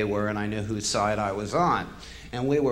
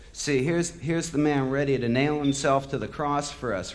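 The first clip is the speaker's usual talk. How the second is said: usual speaking voice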